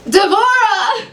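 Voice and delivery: high, urgent call for her psychic; upset client. Acted. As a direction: call her name in alarm.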